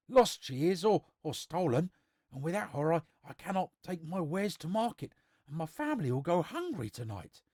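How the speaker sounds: put-on high-pitched rustic old man; an anxious old villager pleading his case; old man explaining.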